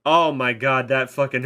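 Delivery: normal talking voice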